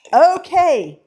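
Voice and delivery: loud, high, exuberant burst; triumphant. Real, not acted. Baker moving on after hitting the target, triumphant. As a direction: call it out high and loud in victory. Celebrating, moving on to next step.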